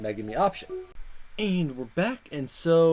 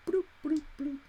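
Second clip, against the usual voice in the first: silly bloop noises; 11 semitones higher, 8 dB quieter than usual. Acted. Imitating a time-skip sound.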